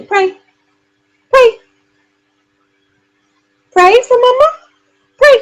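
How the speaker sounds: very high, sing-song pet voice; baby talk to a dog. Acted. Owner coaxing her dog Charlie to pray, high baby talk. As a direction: coo at the dog like a toddler.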